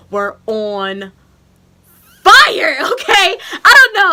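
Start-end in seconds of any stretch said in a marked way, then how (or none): 2.2-4.1 half-laughing shout, higher; loud. Real, not acted. Nipples on fire after surgery.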